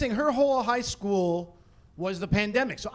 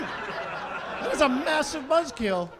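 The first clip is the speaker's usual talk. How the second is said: ordinary talk, nothing marked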